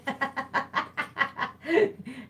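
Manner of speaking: giggle. Real, not acted. Laughing after greeting.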